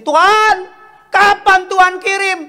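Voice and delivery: high, loud mock plea to heaven; crying out. Preacher imitating singles asking God for a partner, loud cry. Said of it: "kadang-kadang bukan berteriak".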